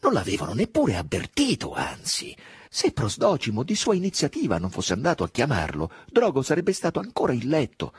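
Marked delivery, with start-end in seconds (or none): 0.0-3.8 pitched high and loud with mounting indignation; rising bitter anger. A narrator's reading. Drogo fuming at being bypassed by his officers, bitter rising anger. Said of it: "un'ira cocente ed amara". 6.1-7.9 bitter anger. A narrator's reading. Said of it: "un'ira cocente ed amara".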